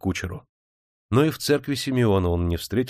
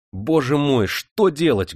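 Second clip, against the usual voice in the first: grieving outburst; 5 semitones higher, 4 dB louder, slower than usual. A narrator's reading. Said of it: "горестно вырвалось".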